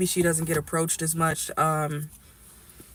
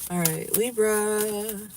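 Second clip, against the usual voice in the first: slow, drawn-out announcer opening; slow intro; much slower than usual. Acted. Reader greeting Libra viewers, slow drawn-out opening. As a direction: announce the next sign slowly. Reader opens a new sign.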